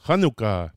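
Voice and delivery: "Hanukkah" with a throaty Hebrew chet, not an H. Shown as a demonstration. Teacher introduces first Hebrew word, "Hanukkah" with throaty chet. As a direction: begin "Hanukkah" with a raspy back-of-throat kh. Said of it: "that's with a chet, not an H".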